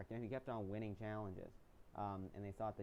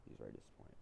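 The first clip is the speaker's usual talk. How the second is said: He's very disappointed, repeated as a low, much softer whisper; mock mournful whisper; 12 semitones lower, 9 dB quieter, faster than usual. Shown as a demonstration. Storyteller repeating the friend's reaction as a mock-sad whisper.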